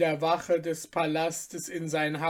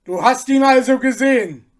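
higher, loud roar; roaring soldier; 6 semitones higher, 11 dB louder, faster than usual. Acted. Soldier roaring at Abner for confirmation. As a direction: bellow the question. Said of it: "brüllte ihn der Soldat an".

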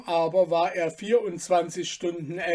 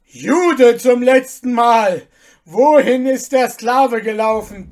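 higher, loud furious demand; final warning; 5 semitones higher, 12 dB louder than usual. Acted. General giving Abner a last loud ultimatum.

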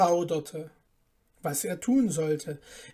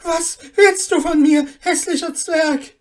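high, very loud shriek of horror; horrified cry; 11 semitones higher, 13 dB louder than usual. A narrator's reading. Mother recoiling from a strange dwarf, horrified cry. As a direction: recoil and scream it out. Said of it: "mit einem Schrei des Entsetzens zurück".

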